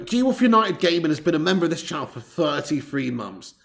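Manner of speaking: usual speaking voice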